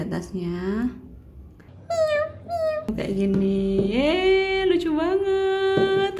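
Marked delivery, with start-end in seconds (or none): 3.9-6.1 high, very slow delighted squeal; cute squeal. Real, not acted. Gushing over finished craft.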